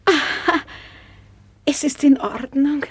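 normal talking voice

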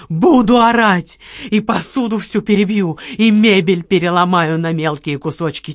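loud tantrum; furious shouting. Acted. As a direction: rage at her. Furious partner threatens.

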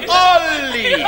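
loud, high cry of delight with a laugh; joyful greeting. Acted. Old friend arriving, calling out in delighted laughter. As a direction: call his name like a long-lost friend.

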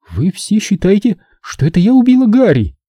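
high, rising hurt question; wide-eyed disbelief. A narrator's reading. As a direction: ask them all in disbelief. Zelda asks the accusers. (высокий поражённый вопрос)

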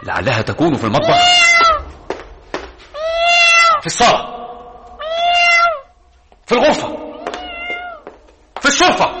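high, loud, frantic cries; panicked shouting. Acted. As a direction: lose your mind shouting. Frantic search, head spinning.

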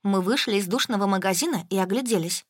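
ordinary delivery, nothing marked

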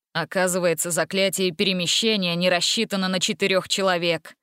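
plain reading voice

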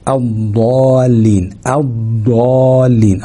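الضالين drawn out very slowly, doubled ض; ترتيل الضالين ببطء بضاد مشددة; slow recitation demo. Shown as a demonstration. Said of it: "بقى عندي حرفين".

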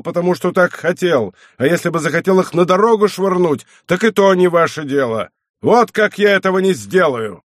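high, forceful angry outburst; boiling over. A narrator's reading. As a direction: rage back as an offended abbot. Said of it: "аббат мгновенно вскипел".

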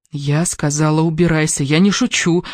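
plain reading voice

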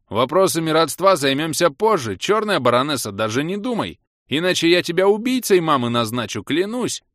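громко и высоко, с напором; перебей и пригрози, повысив голос; возмущённый вопль. A narrator's reading. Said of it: "завопил я". (панический протест на крике)

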